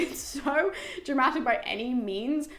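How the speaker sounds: ordinary talk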